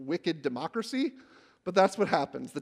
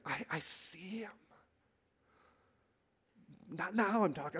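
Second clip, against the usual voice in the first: breathless awe; 9 dB quieter than usual. Acted. Take my breath away.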